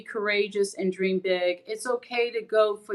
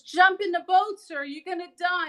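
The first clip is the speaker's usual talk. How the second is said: higher, louder urgent call from the boat; urgent rescuer; 10 semitones higher, 4 dB louder than usual. Retold or imitated. Rescuer on a boat urging the drowning man, higher and louder. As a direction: shout a warning at a stubborn man. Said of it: "the guy says".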